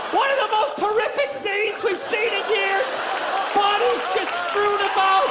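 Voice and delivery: hammed-up TV news voice; news anchor. Mock news report.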